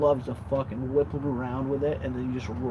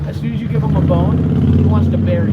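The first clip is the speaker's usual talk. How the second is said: exasperated; 6 semitones higher, 14 dB louder than usual. Real, not acted. Loud complaint about dog.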